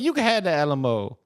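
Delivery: high, mocking caricature; exaggerated imagined politician quote; Jasmine Crockett. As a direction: pitch it way up, hammed-up. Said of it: "She said, she's probably".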